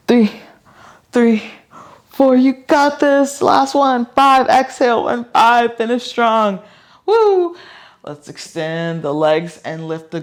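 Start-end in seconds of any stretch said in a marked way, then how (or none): 0.1-7.6 high, loud encouraging push; cheering coach. Real, not acted. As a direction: pump them up for the finish. Coach cheering through last reps.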